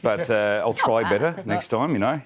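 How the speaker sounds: light, self-deprecating Australian accent; attempted Australian accent. Shown as a demonstration. Guest showing the hosts his shaky attempt at an Aussie accent, Australian accent. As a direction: try a loose Aussie accent. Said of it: "Can you do an Aussie accent?".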